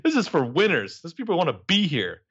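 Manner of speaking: mocking the counsellor's brush-off; dismissive Mona. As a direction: brush him off in a high, snotty voice. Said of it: "And she's like".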